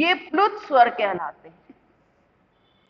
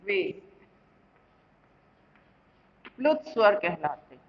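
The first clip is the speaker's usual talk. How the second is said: dictation; 5 semitones lower, 5 dB quieter than usual. Shown as a demonstration. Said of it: "पहले इसकी परिभाषा लिख लो".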